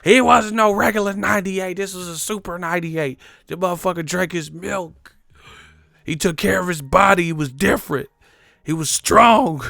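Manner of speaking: put-on a beaten man swearing the old man was special; mock defensive excuse.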